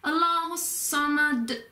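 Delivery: slow, intoned "Allahu Samad", holding the "hus" into Samad; held linking sound. Shown as a demonstration. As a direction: link "Allahu Samad" through a long s. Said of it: "hold the hus sound for a bit".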